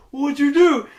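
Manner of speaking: high, loud, startled what happened; startled shout. Retold or imitated. Friend blown away by the gadget, loud startled shout. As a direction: cry out in shock.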